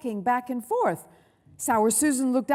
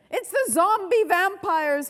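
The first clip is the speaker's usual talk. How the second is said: high-pitched panicked wail; terrified howl; 9 semitones higher, 4 dB louder than usual. A narrator's reading. Ted seeing ketchup-covered teachers, terrified howl. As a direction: howl it out in horror. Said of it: "howled Tidy Ted".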